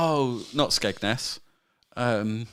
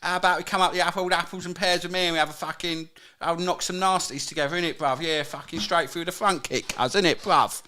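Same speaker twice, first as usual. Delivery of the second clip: higher-pitched, hammed-up London slang accent; attempted co-host accent; 5 semitones higher than usual. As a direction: do a cartoon cockney geezer accent. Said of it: "trying to do your accent".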